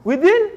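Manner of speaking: within lifted high on a rising tune; emphasized repeat. Shown as a demonstration. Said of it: "is already within".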